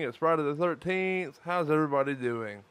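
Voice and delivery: ordinary delivery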